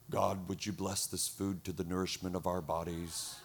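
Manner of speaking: low, hushed, monotone mock prayer; solemn prayer voice. Man praying over offering by mistake for lunch, mock-solemn. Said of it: "he had the right voice".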